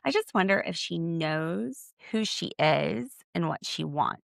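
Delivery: normal talking voice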